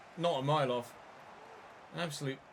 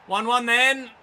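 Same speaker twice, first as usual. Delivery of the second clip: higher and louder, in bitter mock cheer; sarcastic exclamation; 8 semitones higher, 12 dB louder, slower than usual. Real, not acted. Gamer reacting to the equaliser with loud sarcasm. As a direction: throw it out with sour irony.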